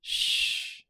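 a hushing breath, no voice; calm her with a gentle shush; soft shush. Acted. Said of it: "she says again".

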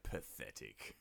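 low, quiet mock disdain; scornful bear, deep and soft; contemptuous. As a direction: drop it low and dismissive. Bear sneering at beaten Jeffrey.

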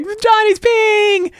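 very high, drawn-out squeal; shrieking goblin kid. Acted. Goblin kid tattling on another, slow high shriek. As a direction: stretch it into a squeaky mock shout. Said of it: "screaming from the, go".

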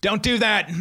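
high mock yell; retold yell. Lifeguard warning a kid who ignores him, mock yelling. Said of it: "And I'm yelling".

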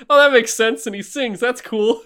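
lightly voiced accepting child, pitched high; kid's easy acceptance. Recalling a kid who doesn't question it, lightly mocked acceptance. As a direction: go high and chirp along unquestioningly.

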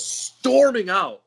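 STORMING drawn out high; slow, high-pitched punch on "storming"; dramatic emphasis. Retold or imitated. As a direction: stretch "storming" slowly and raise the pitch. Teller dramatizes angry exit.

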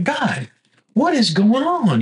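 exasperated question thrown at the sky; fussing at God. Retold or imitated. Retelling his complaint to God, mock exasperated. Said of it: "I'm kinda fussing at God".

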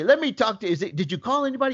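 high, hurried retold father; impatient dad. Dad interrupting son on the phone, impatient. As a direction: imitate a dad breaking in with urgent questions. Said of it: "I heard him say".

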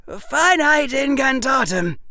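loud, high-pitched shouted spell; hoarse incantation shout. A narrator's reading. Harry casts a spell on the locked door, hoarse shout. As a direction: cast it at full voice, straining to hoarseness. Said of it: "Harry's voice came out rather hoarse".

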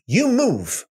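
high punch on You; final order to move; commanding God. Acted. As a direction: snap the command, You first. God's closing command to Moses.

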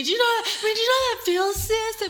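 hammed-up poor-me plea to her sister; mock dramatic plea. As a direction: ham up the poor-me act.

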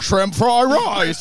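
exaggerated Chinese-accent caricature. Shown as a demonstration. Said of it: "That's my Chinese accent".

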